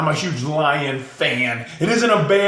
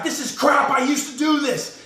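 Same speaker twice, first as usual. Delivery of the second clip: higher, frustrated exclamation; mad outburst; 8 semitones higher than usual. Retold or imitated. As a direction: vent like a lifter who just failed a lift. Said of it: "He gets mad".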